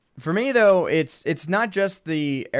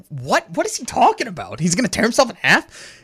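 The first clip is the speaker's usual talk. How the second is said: higher, mock-incredulous questioning; baffled disbelief; 5 semitones higher, 4 dB louder than usual. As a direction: imitate teammates thrown by a crazy plan. Acted teammates baffled by the plan.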